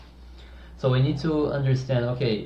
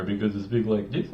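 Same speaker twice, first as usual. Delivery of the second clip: low voice demo; 3 semitones lower, 4 dB quieter than usual. Shown as a demonstration. Coach demos an annoying voice, lower and softer.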